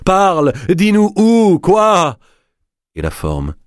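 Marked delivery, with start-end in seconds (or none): 0.1-2.2 much higher, slow, pressing the spirit to answer; urgent coaxing question, drawn out; a medium begging the spirit to speak, high and slow; eager plea. A narrator's reading. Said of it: "disait engageante Madame Olcott".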